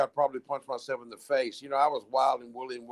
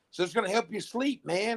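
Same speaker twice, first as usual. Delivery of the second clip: mock doctor reassurance; 7 semitones higher than usual. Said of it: "they had me on some stuff".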